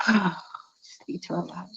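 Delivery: slow whisper after a tired sigh; sigh, then whisper it slowly to yourself. Retold or imitated. Michael in shower, sighing.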